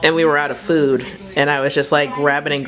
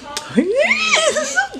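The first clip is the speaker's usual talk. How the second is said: very high, drawn-out squealing laugh; gleeful squeal. Real, not acted. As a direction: squeal with glee. Delight as the child gets bread.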